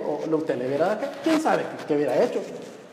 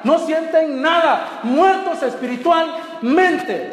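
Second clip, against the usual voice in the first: loud, high, slow hammering sermon; fiery preaching; 9 semitones higher, 4 dB louder, slower than usual. Acted. Preacher rebuking the congregation's insensitivity, loud and slow. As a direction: pound each phrase at the church.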